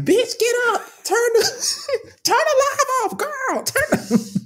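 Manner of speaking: squeaky falsetto, laughing through it; shrill mock chat reader, cracking up; high cartoon voice reading the comments; mocking commenter voice. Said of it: "your comments are scrolling like".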